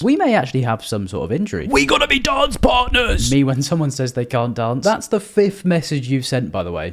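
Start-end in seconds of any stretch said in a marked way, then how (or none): 1.7-3.3 much higher, louder overexcited shout; excited shout. Retold or imitated. Mocking a guy's message with an overexcited shout. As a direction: yell it like a thrilled fan. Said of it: "Shouting it too".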